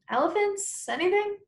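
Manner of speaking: put-on high, hopeful child voice; boy asking dad hopefully; hopeful kid question. A narrator's reading. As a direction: lift the pitch way up, hoping.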